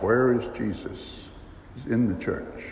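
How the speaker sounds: hushed repeated answer. Shown as a demonstration. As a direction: drop the voice to a hush for the answer.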